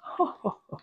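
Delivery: airy little laugh without voice; soft laugh. Real, not acted. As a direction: let a tiny laugh slip out. Laughing while tasting.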